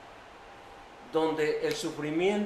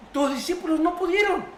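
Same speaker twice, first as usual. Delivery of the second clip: distressed father; 9 semitones higher, faster than usual. Acted. Father tells Jesus, distressed.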